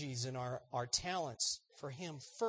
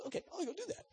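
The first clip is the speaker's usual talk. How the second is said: eager obedience; 14 semitones higher, 4 dB quieter, faster than usual. Acted. Wolfgang readily obeying God.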